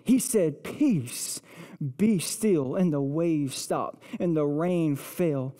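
low, soft, awed storytelling; hushed preaching. Real, not acted. Preacher telling who was in the boat, hushed preaching. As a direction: drop low and let each phrase settle.

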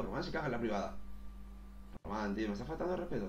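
ordinary talk

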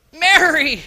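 Jesus calling out Mary; calling her name. Acted. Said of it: "I don't know how he said it".

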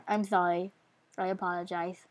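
usual speaking voice

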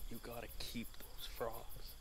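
usual speaking voice

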